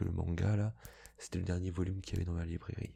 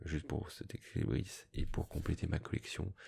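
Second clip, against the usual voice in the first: usual speaking voice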